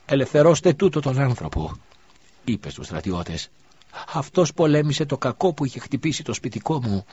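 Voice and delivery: a mother demanding his release; widow commanding. A narrator's reading. (η χήρα διατάζει τους στρατιώτες)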